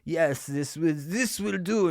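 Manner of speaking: mock German watchmaker, slow and fussy; a hammed-up German accent, slow and picky; a pretend German craftsman inspecting his tiny work. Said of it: "like, a German fucking accent".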